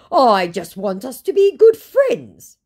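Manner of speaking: mock the scammer begging; imitating a whiny scammer; whiny mock plea.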